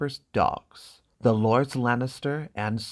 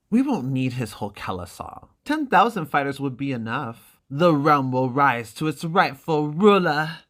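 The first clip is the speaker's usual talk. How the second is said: louder, rising ambition; lightly voiced ambitious prince; scheming brother; 4 semitones higher, 6 dB louder than usual. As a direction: build to a royal claim. Viserys scheming for an army.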